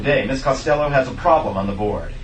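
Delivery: ordinary talk, nothing marked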